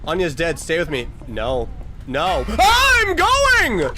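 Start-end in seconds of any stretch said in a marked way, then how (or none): 2.1-3.9 high, very loud, whiny yell; loud protest at being pestered; exasperated scream. Real, not acted. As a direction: shriek it with the last word stretched out.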